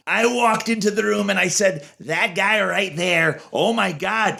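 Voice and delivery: high-pitched presidential impression with stretched phrase endings; Donald Trump. Shown as a demonstration. Showing he needs no Trump voice preset, mock-Trump impression. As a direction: impersonate the politician, pitched well above your own voice. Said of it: "I don't do the Trump one".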